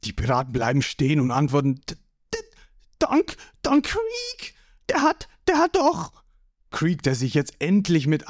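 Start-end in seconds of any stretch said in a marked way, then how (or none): 1.9-6.2 high, strained stuttering; intimidated underling's squeal; flustered stammer. A narrator's reading. Startled pirates stammer to their boss.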